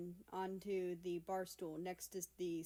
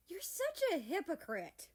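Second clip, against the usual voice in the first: higher, louder accusing cry; indignant outburst; 10 semitones higher, 5 dB louder than usual. Acted. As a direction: throw the accusation at her. America accuses his guardian.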